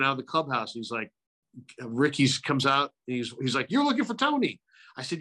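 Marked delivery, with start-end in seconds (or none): 3.7-4.6 imitating Ricky Henderson.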